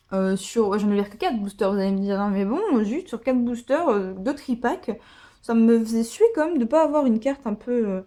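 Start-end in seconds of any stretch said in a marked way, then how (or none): none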